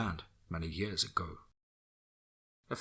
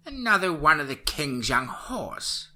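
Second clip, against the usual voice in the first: put-on old witch voice; 7 semitones higher, 5 dB louder, slower than usual. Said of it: "she replied".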